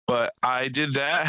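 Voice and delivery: ordinary talk, nothing marked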